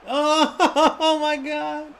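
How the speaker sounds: high, loud laughing oh my God; excited laughing outburst. Real, not acted. Gamer shouting and laughing at a goal. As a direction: burst out in delighted disbelief.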